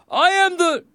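high, loud proclamation, cut off; enraged shout; angry king. A narrator's reading. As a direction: start a furious royal announcement. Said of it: "made the king even angrier".

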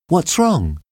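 high, concerned female question; anxious high query; worried. A narrator's reading.

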